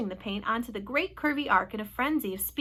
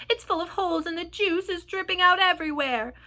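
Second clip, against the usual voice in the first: raised, higher voice of alarm; calling out the bad news, high; alarmed shouting; 7 semitones higher, 5 dB louder than usual. A narrator's reading. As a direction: report the damage in a panic. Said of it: "shouted the old green grasshopper".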